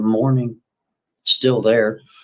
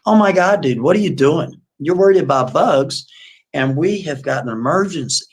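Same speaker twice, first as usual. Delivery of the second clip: higher, louder disbelieving protest; exasperated outburst; 5 semitones higher, 4 dB louder than usual. Retold or imitated. Kathy scolding her date over his fireflies, exasperated and loud. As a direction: scold him for caring about bugs. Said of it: "she's looking at him like".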